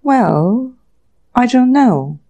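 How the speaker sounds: mildly guarded and unsure; cautious. A narrator's reading. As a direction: answer warily, drawing back a little. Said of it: "said Mister Eastwood cautiously".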